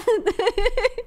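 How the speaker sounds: high, rising giggle; giggling. Real, not acted. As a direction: break into a high laugh. Laughing at own argument.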